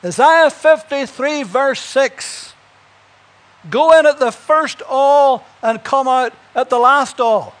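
loud, high call from a train window; Moody shouts from the moving train; shouting back. Acted. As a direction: shout it over the noise. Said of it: "Moody shouted back".

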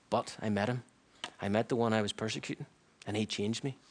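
softened, low-key confession; quiet testimony. Retold or imitated. Apostle telling the synagogue he was changed, quietly. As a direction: tell it softly, as a humble turn.

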